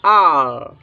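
"आल" with a long open aa; alternative pronunciation. Shown as a demonstration. Teacher offers another reading, "आल" with long open aa. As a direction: draw out "आल" slowly with an open vowel. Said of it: "इसको हम क्या पढ़ेंगे".

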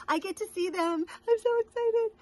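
high giddy squeal; real excitement. Real, not acted. Friend about to see her friends, giddy excitement. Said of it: "just excited".

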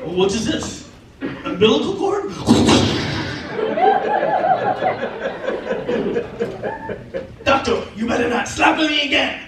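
lightly voiced indignant newborn; talking newborn. Comedian voices his newborn self to the doctor, mildly indignant. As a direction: play a hint of a baby protesting to the doctor. Newborn baby talks back in a bit.

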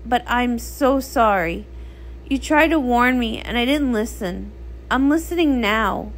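ordinary delivery

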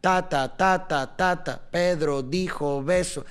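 rhythm demo. Shown as a demonstration. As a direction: beat out "ta, ta", then put "Pedro dijo beso" on the same pulse. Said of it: "esa es la musicalidad".

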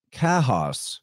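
"Kahos" as KAH-hoss; Greek-style pronunciation. Shown as a demonstration. Host linking Khasis to the Greek, "Kahos" said KAH-hoss. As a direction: open ah in "Kahos", then a falling second syllable. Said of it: "it was more like Kahos".

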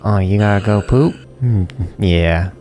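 normal talking voice

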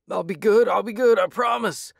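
very high, loud desperate promise; tearful pleading. A narrator's reading. Thug promises the hero to behave, tearful and high. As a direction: beg for mercy. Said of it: "he panted tearfully".

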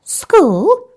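high, loud tadpole squeak of surprise; Tim the tadpole, puzzled. A narrator's reading. Tim the tadpole to Bertie, a high puzzled squeak. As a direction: surprise pitched right up like a small child. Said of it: "said Tim".

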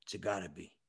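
put-on Italian accent. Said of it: "off the boat Italian guy".

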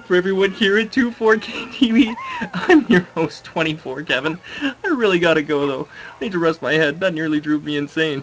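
loud, upbeat host sign-off; lightly hammed-up TV outro; show host outro. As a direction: wrap up the episode like a TV host.

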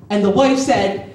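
usual speaking voice

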